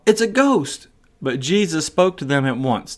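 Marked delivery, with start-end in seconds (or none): 0.1-0.9 high, sudden cry of fright; frightened cry. A narrator's reading. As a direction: shriek it out in terror, high. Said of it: "they cried out".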